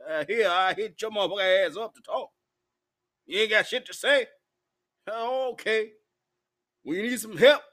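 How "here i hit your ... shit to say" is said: normal talking voice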